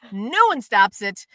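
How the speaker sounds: ordinary talk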